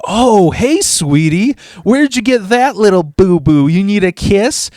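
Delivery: high, loud fake-sweet cooing; mocking sweet talk. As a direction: taunt him as if babying a child. Taunts injured bully with baby talk.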